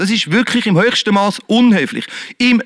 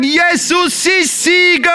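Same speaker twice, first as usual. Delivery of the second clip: shout; 9 semitones higher, slower than usual. Shown as a demonstration. Preacher demonstrating a full-voiced shout to the congregation. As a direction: push the air out and shout it. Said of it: "Pump die Luft raus und sag".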